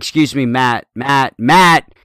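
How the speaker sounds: yelling a name at a customer walking away; retold yelling. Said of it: "I'm, like, yelling at him".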